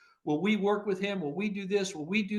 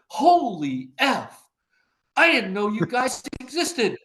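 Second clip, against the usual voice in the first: high-pitched, amazed rush of praise; gushing producer; 7 semitones higher, 4 dB louder than usual. Retold or imitated. Visiting TV producer raves about the agency, high and amazed. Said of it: "she's an F-bomb machine".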